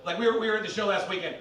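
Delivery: ordinary talk, nothing marked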